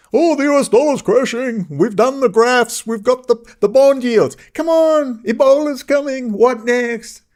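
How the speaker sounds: high, hammed-up doom-monger voice; mock alarmist. Mocking doom-sayers' alarmist talk.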